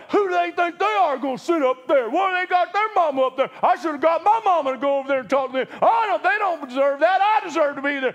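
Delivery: high, loud mock grumbling of the apostles; jealous griping. Preacher voicing the jealous apostles, loud and high griping. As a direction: gripe like a jealous sibling. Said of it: "They got mad at them going".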